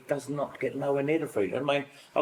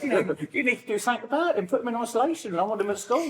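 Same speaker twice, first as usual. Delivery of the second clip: indignant mum; 11 semitones higher than usual. Retold or imitated. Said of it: "She went".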